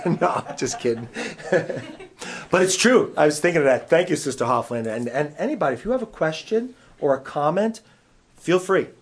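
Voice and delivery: ordinary delivery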